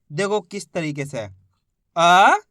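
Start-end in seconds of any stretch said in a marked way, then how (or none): 2.0-2.4 अ said alone, loud and held; sounding out letters. Shown as a demonstration. Teacher showing letter-by-letter reading, अ sounded out alone. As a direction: give अ as one separate, drawn-out sound. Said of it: "एक एक अक्षर का उच्चारण करना है".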